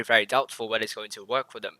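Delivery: ordinary talk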